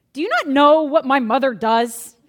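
put-on a kid loudly defending his mom; indignant son. Son tells the new girl his mom's job, loud and incredulous.

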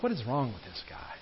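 hushed, half-whispered aside; imitating a baffled onlooker, hushed; muttered bewildered thought. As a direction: say it under your breath, as a private thought. Congregant's puzzled thought, voiced by preacher.

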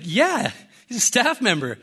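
high-pitched comic insistence; insistent retort. Retold or imitated. Insisting to the old lady, high exasperated mock retort. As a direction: pitch it up, as if stating the obvious. Retelling his insistent reply.

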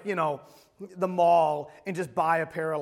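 normal talking voice

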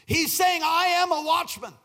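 high, emphatic pulpit declaration; forceful preaching. Real, not acted. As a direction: proclaim the point to the congregation. Preaches the point at full force.

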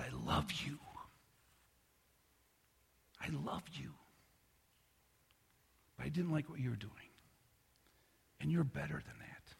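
put-on a father reassuring his child on his lap, soft and tender. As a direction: hold the kid close and speak gently.

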